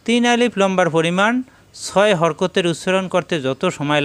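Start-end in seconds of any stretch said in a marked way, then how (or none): none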